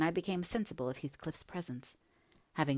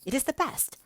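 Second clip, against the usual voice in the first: much higher, louder insistence; sharp retort; 12 semitones higher, 7 dB louder than usual. A narrator's reading. Young woman defending her motive for marrying, retorting. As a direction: insist on it, pitch jumping up. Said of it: "retorted she".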